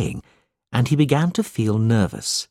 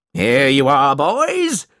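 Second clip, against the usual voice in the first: schoolmaster's singsong holler; crying out; 2 semitones higher, 4 dB louder, slower than usual. A narrator's reading. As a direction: call out grandly to a group, pitch soaring. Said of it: "cried Mister Squeers".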